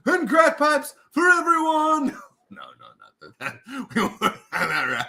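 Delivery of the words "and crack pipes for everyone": high, slow shouted promise; pandering politician. Pretend. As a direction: shout a campaign pledge to the crowd. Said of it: "the politician voice".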